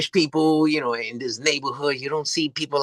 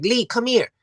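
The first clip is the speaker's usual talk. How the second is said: higher-pitched mock call-over; retold calling out; 8 semitones higher than usual. Calling Lee to see the strange light, higher urgent call.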